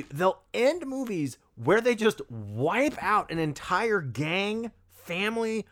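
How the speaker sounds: ordinary delivery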